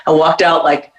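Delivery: normal talking voice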